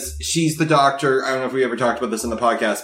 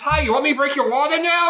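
chirpy doctor; 14 semitones higher than usual. Retold or imitated.

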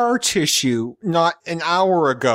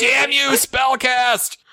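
much higher hammed-up curse; mock angry yell; 10 semitones higher, much slower than usual. Stranded driver cursing the friends who hung up, mock yell. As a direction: shake a fist at them.

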